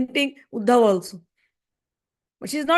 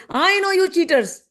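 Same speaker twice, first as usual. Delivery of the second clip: raised-pitch mock outburst; angry accusation; 7 semitones higher than usual. Radharani accusing the bumblebee and its master, acted indignation. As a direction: accuse them, pitch lifted high. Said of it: "she's shouting at Bumblebee".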